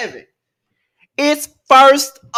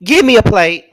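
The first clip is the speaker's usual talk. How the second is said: louder, emphatic ask; eager demand; 2 semitones lower, 5 dB louder than usual. Real, not acted. Hungry comic demanding a plate, louder.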